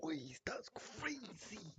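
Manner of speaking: slow awed whisper. Real, not acted. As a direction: breathe it out in disbelief. Stunned at win.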